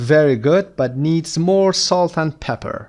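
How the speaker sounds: slow model reading. Shown as a demonstration. Teacher reads English model slowly.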